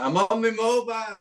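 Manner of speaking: lightly mimicking a friend; high teasing echo of his words; mimicking Gaz. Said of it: "that was funny".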